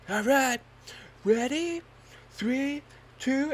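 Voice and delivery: high, loud countdown; imitating the instructor's countdown. Instructor counting down the jump, high and loud. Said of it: "And he's like".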